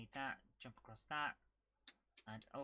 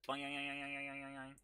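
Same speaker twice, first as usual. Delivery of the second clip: bouncing boing noise. Real, not acted. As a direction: run of boings on one note. Imitates the bouncing game character.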